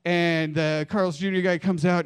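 normal talking voice

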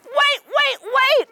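high rising wait cries; high shout. Acted. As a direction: cry out to stop the wedding. Characters cry wait, kids join.

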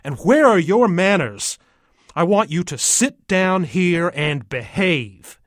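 loud, high, stern scolding; angry yell. A narrator's reading. Father orders the kid to sit and behave, yelling. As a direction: lay down the law as a furious father. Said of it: "my father yells".

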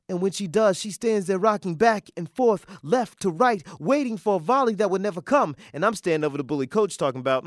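rhythmic, rolling verse; spoken-word poem. Acted. Poet describing Venus awaiting the volley, spoken word. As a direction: perform it with a steady sway.

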